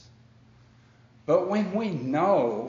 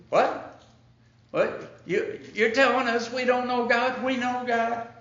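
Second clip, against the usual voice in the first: high, outraged mock Pharisee voice, pitched up throughout; indignant disbelief; 9 semitones higher than usual. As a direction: play the offended religious crowd, scoffing at him. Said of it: "And it's like".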